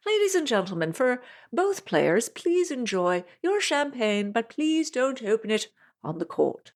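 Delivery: playful British umpire, slowed and proper; hammed-up British accent as the Wimbledon umpire; fake British accent. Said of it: "Thus there ends my fake British accent".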